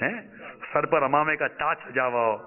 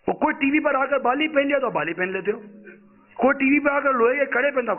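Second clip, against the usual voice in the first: preaching at full force; 8 semitones higher than usual. Acted. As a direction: rail at the congregation about copying TV fashion. Preacher rants about fashion.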